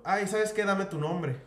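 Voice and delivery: mock arrogant clerk ordering him; rude clerk. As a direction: push him around like a smug clerk. Rude clerk voiced in story.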